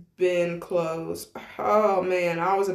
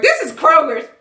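louder, higher "this is Kroger's" in delight; excited recognition; 7 semitones higher, 9 dB louder than usual. Retold or imitated. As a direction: announce it like a big discovery. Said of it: "was like".

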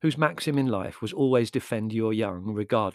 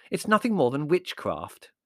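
mildly indignant, higher pitch; a touch of huffy protest; bristling; 7 semitones higher, 4 dB louder than usual. A narrator's reading. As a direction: bristle with prim disapproval. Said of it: "bristling like a plump kitten".

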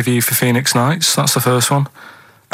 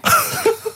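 high burst of laughter. Real, not acted. Laughs before reading clue.

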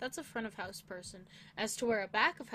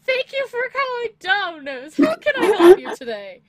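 high, loud, bright phone greeting; sweet overly polite phone voice; customer service voice; 13 semitones higher, 21 dB louder than usual. Retold or imitated. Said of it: "You gotta have that customer service voice".

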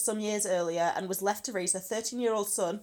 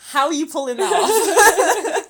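high, loud and slow through laughter; mock amazement, cracking up; laughing at her friend's accent; laughing tease; 9 semitones higher, 14 dB louder, slower than usual. Real, not acted. Teasing co-host's Scottish "Aye.

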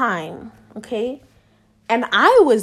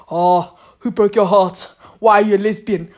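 slightly lowered voice, lightly put on; imitated nosy questioner; 2 semitones lower than usual. Imitates nosy person questioning her.

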